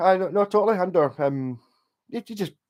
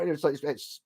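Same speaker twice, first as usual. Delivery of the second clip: ordinary talk, nothing marked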